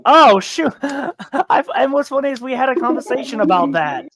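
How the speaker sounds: high, loud burst with a laugh; real surprised realization. Real, not acted. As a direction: blurt it out, laughing as it dawns on you. Suddenly remembers after the correct guess.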